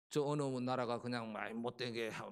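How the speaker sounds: normal talking voice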